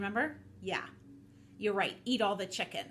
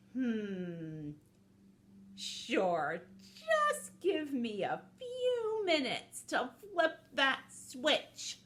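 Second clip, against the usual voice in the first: slow, drawn-out, sliding pitch; falsely obliging villain; scheming snake, stalling; 4 semitones higher, much slower than usual. Acted. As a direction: play for time slyly.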